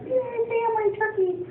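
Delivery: high, sing-song fake cheer; hammed-up holiday delight; mock cheer. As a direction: chirp it with fake enthusiasm.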